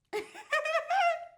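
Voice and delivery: very high, loud cackling laugh; real laugh. Real, not acted. Storyteller laughs at him calling her rich, real laugh. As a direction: burst into a high-pitched laugh.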